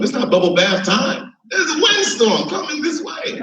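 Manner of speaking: ordinary talk, nothing marked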